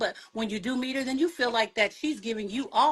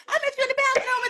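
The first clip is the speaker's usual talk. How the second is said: high, teasing Whitney voice; [name] impression; 4 dB louder, much faster than usual. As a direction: pitch it up like Whitney. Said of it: "Now you know how she talked".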